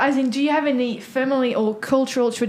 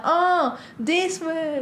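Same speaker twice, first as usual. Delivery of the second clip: acted sudden realisation; 5 semitones higher, much slower than usual. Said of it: "and they're like".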